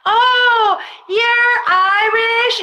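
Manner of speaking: high, drawn-out mock amazement; hammed-up delighted surprise; acted amazed reaction. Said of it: "and then people are like".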